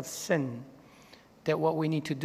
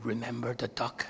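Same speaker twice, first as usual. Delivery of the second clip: much softer, hushed threat; quiet, knowing sister's whisper; 6 semitones lower, 10 dB quieter than usual. A narrator's reading. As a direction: whisper it as a sly warning to her brother. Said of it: "Again, she whispered".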